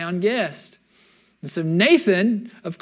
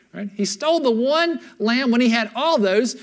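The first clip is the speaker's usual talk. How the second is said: ordinary delivery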